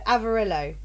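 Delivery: Avarillo like Amarillo, short i in "rill"; "Avarillo" with a short i and sounded l; first pronunciation. Shown as a demonstration. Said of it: "on the first time".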